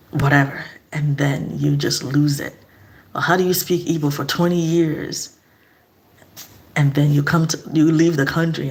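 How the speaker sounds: ordinary talk, nothing marked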